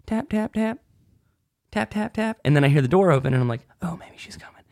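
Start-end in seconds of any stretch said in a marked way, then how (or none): 0.1-2.4 high, soft tap tap tap; soft tapping. Retold or imitated. Knocking on the girl's window at night, hushed. 3.8-4.6 hopeful whisper. Retold or imitated. Waiting outside the camper door, whispering.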